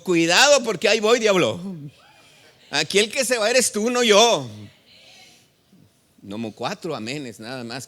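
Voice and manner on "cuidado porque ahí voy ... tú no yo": loud, fast defiant shout; bold shout. Acted. Preacher defies the devil before congregation, loud fast shout. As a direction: taunt the devil head-on. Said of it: "No, yo le digo".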